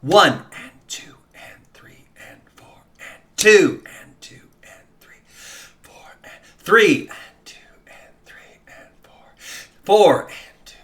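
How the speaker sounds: breathy whispered numbers with a stronger first beat; whispered count. Shown as a demonstration. Teacher whispering the count as a demonstration. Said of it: "first number louder and then whispering the rest".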